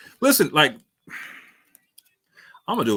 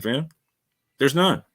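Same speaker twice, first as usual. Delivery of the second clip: ordinary delivery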